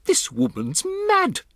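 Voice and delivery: a snobbish wife scoffing in disbelief; indignant lady. Acted. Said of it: "Missus Jenkins said".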